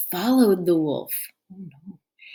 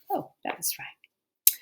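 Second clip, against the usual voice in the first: whispered aside; 13 semitones higher, 5 dB quieter than usual. Real, not acted. Aside correcting page.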